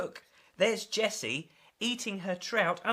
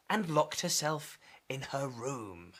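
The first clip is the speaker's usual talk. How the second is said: whisper; 6 semitones lower, 5 dB quieter than usual. A narrator's reading. Secret plan, told hushed.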